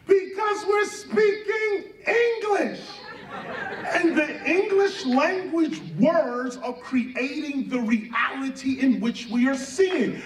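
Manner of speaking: high-pitched laughing outburst; laughing rant. Real, not acted. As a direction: laugh your way through the point, rising to a shout. Laughing while ranting about English.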